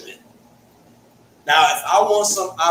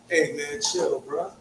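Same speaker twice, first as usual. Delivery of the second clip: slow Hartford talk; 5 semitones lower, 8 dB quieter, slower than usual. Shown as a demonstration. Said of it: "Hartford, we talk slow".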